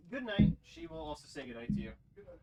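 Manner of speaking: voicing dog. Talking to dog.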